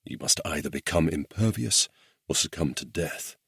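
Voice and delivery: usual speaking voice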